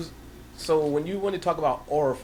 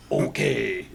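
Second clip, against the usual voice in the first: American accent imitation; 9 semitones lower than usual. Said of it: "they say the most basic lines".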